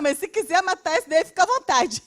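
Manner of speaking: usual speaking voice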